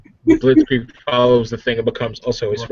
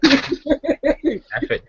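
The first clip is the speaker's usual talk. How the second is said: squeaky give up. Pretend. Player gives up jokingly.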